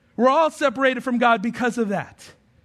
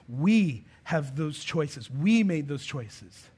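very slow, lower and softer; slow solemn; 7 semitones lower, 5 dB quieter, much slower than usual. Real, not acted. Preacher quietly pressing home our choices. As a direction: let each word sink in. Preacher contrasts have and made.

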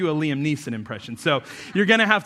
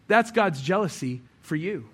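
ordinary delivery

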